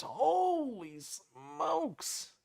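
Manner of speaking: very drawn-out, high-pitched amazement; astonished. Real, not acted. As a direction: stretch each word in real shock at the price. Reacts to a four-thousand-dollar vet bill.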